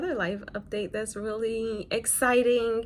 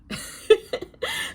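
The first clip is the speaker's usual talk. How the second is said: breathy laugh; 4 dB louder than usual. Real, not acted. Laugh after her reply.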